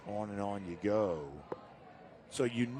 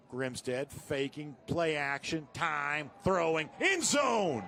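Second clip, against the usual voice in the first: building play-by-play; 4 semitones higher, much slower than usual. Real, not acted. Announcer follows a throw to the end zone, building excitement. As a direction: stretch each phrase, rising as the ball flies. Live call of a deep pass.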